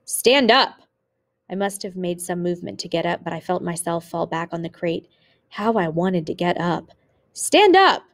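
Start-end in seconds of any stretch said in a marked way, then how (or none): 0.0-0.8 raised, higher command; shouted order. A narrator's reading. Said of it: "what he was shouting". 7.3-8.0 loud, very high-pitched yell; yelled order. A narrator's reading. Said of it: "he was yelling even more loudly".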